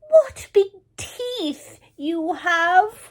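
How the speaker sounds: ordinary delivery, nothing marked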